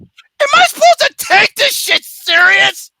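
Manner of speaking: high, loud, incredulous. Retold or imitated. Reacting to the screaming cultist, loud mock disbelief. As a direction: blurt out the disbelief. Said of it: "I'm like".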